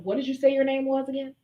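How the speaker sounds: high, airy dismissive question; bored, indifferent tone; mock unbothered. Shown as a demonstration. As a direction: brush them off lightly. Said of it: "to hashtag unbothered".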